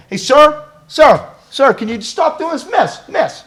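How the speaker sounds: high, loud call to a stranger. Acted. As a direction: call out insistently for their attention. Calling an unnamed stranger to stop.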